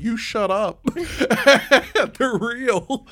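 laughing mock retort. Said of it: "He's like".

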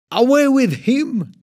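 high, loud crowd yell. A narrator's reading. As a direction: scream it at the governor. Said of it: "they yelled".

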